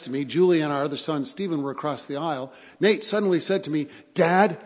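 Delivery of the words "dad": loud urgent call to dad. Retold or imitated. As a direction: call out across the plane. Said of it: "he said it in a loud voice".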